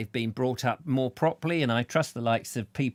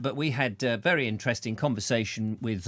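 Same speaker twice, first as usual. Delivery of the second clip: usual speaking voice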